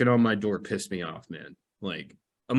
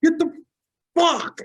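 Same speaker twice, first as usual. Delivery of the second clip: mock shout; 9 dB louder than usual. Retelling yelling at the pestering roommate, high and loud. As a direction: yell him away from the door. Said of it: "I'm like".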